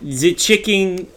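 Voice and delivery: slow, deadpan German-accented pronouncement; deadpan, every word drawn out in German accent; Werner Herzog. Shown as a demonstration. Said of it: "He has a very deadpan voice".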